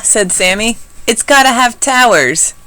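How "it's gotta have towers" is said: high, loud eager demand; insist, all fired up. A narrator's reading. Sammy joins in building the fort, high and eager.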